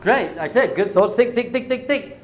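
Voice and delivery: high, loud, hammered-out repetition; loud mock urging. Teacher answering a question on good thoughts, loud mock urging. Acting out insistent thinking, voice raised.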